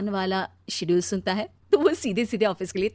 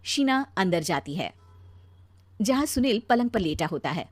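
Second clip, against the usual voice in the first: ordinary delivery, nothing marked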